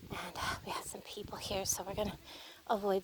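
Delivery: hushed whisper so strangers won't hear. Real, not acted. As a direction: drop to a whisper. People nearby, lowers voice.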